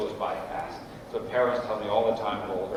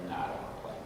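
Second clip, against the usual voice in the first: soft, low, offhand little mumble; retold kid's refusal; 6 semitones lower, 11 dB quieter, much faster than usual. Small son to his dad at his first game, soft refusal. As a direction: quietly beg off like a small boy.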